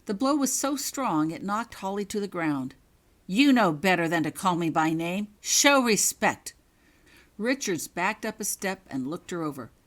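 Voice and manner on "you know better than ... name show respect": angry scolding. Acted. Prefect berating first-year Holly, loud angry rebuke. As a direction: lay down the law harshly.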